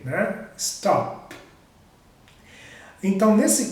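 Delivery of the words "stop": "Stop" ending in an unvoiced P; voiceless final P. Shown as a demonstration. Teacher proving the cords don't vibrate, "Stop" with voiceless P. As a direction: release the P of "Stop" without any vocal-cord buzz. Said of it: "não vibra quando você pronuncia o P".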